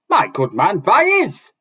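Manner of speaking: high, loud dwarf voice rising sharply; dwarf answers Rand, pitched high and loud; dwarf exclaiming. Acted. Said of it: "the dwarf exclaimed with a laugh".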